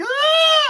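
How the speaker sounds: high falsetto scream of panic; loud mock scream; imitated scream. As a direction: shriek like a child under attack. Imitates girl attacked by dinosaurs.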